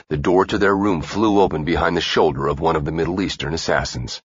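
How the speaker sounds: usual speaking voice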